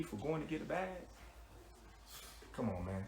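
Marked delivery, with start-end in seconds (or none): none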